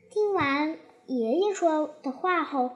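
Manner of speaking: usual speaking voice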